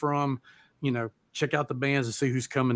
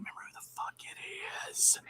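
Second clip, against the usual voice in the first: whisper; 16 dB quieter than usual. Real, not acted. Can't remember the opening band.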